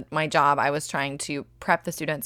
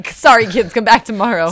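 mock dismissive; 5 semitones higher, 9 dB louder than usual. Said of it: "So just say".

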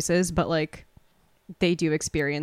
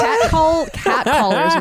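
laughing; 10 semitones higher, 8 dB louder, slower than usual. Real, not acted. Laughs, bursts in loudly.

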